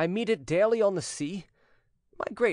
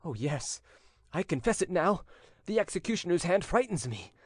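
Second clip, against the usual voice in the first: higher-pitched, impassioned pleading, building in urgency; persuasive entreaty; 7 semitones higher than usual. A narrator's reading. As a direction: implore your father, from confession to urgent appeal. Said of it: "with an irresistible accent of persuasion and entreaty".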